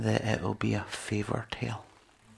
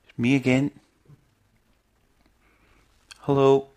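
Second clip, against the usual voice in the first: loud calling prayer; 8 dB louder than usual. Retold or imitated. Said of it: "And I'm just like".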